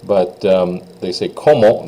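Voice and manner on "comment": Comment with a nasal O sound, ENT silent; French nasal comment. Shown as a demonstration. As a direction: push Comment up into the nose. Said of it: "is up in your nose, so it sounds like an O sound".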